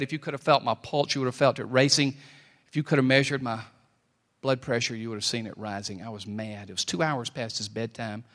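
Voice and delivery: usual speaking voice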